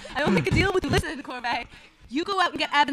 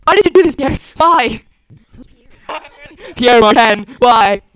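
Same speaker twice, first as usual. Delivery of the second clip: anguished demand; 4 semitones lower, 8 dB louder than usual. Acted. Confronts the dying traitor.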